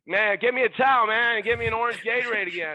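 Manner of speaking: loud, high-pitched barked order; imitated yell. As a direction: yell it out like a demanding star. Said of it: "but he yelled it".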